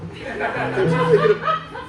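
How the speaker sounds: high, slow, laughing break on the words; mock tearful wobble breaking into a laugh; laughing catch. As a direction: fake choke up, laughter cracking through. Choking up over dead dog.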